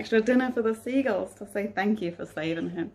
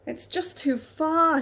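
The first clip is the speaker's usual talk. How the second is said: high, slow worried captain; lightly voiced despairing sailor; captain's voice; 8 semitones higher, 4 dB louder, slower than usual. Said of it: "he said".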